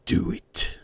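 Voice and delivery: breathless, eerie whisper; sinister whisper. A narrator's reading. Evil voice urging Squidward, in a whisper. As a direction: hiss it like a voice in the dark. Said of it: "and that deep voice said".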